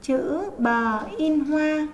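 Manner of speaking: "Chữ bờ in hoa" read very slowly, word by word; slow model reading. Shown as a demonstration. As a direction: spell it out slowly for the children to repeat. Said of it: "Chúng mình đọc cho cô".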